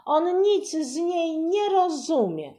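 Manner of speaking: put-on high, loud scornful child; angry sister shouting on; girl yelling. A narrator's reading. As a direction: keep shouting at him.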